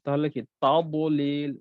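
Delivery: তাবলিল with a full dad, no bounce; dad pronunciation demo. Shown as a demonstration. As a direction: say তাবলিল with a heavy dad, slightly slow. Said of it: "আমি যখন দদ উচ্চারণ করতেছি". (দদ পরিপূর্ণ করে তাবলিল)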